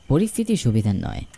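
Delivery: ordinary delivery, nothing marked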